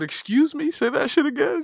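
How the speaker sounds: high-pitched mock disbelief; affronted retort. Host retelling his reply to her, affronted and high-pitched.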